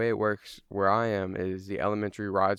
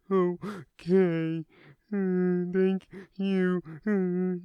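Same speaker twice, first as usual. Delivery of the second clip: put-on high, loud, sobbing small-kid voice; acted kid crying fit, words half understood; crying little kid; 10 semitones higher, 5 dB louder, much slower than usual. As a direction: blubber it out like a panicked little boy. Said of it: "best reenactment I could do of a little kid fucking crying".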